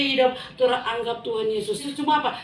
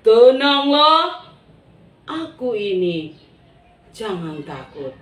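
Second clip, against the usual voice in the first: calm reassurance; 6 dB louder, slower than usual. Acted. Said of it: "Tuhan Yesus bilang".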